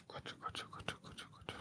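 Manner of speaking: breathy whisper, barely audible; whispered to himself. Real, not acted. Podcast host stuck on a pick, whispering to himself. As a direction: mutter the name under your breath while thinking. Pondering who gets relegated.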